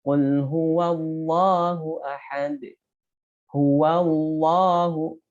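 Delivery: slow, drawn-out huwallahu with a heavy lam; thick lam. Shown as a demonstration. Teacher models heavy lam after fathah, slow. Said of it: "wa-nya berharokat fathah".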